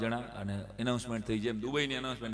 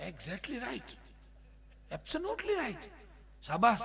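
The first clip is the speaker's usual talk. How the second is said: much higher English praise; mock posh praise; 9 semitones higher, much slower than usual. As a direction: sing out the praise sarcastically.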